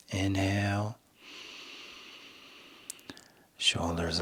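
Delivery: soft, slow breathing-practice voice; guided meditation. Shown as a demonstration. Coach guiding listeners through inhale and exhale, hushed meditation voice. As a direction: hush it and leave room between each cue.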